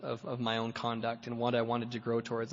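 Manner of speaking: usual speaking voice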